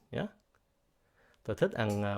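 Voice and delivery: ordinary talk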